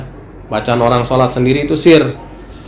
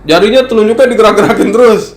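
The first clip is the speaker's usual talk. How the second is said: higher-pitched mock grievance; imitated complaining; 6 semitones higher than usual. Worshipper complaining to the ustadz about a neighbour's finger, raised-pitch grumble. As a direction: play a bothered worshipper bringing a complaint. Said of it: "kadang orang suka ngadu".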